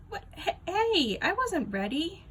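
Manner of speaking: high, loud startled protest; startled by the camera click. Acted. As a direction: caught off guard, blurt it high.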